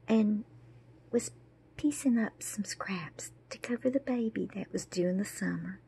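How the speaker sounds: plain reading voice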